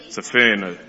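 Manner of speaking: ordinary talk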